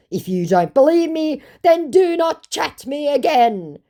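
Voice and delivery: high, mock-outraged scammer voice; indignant scammer. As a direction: play the scammer laying down the law. Voicing the scammer's angry message.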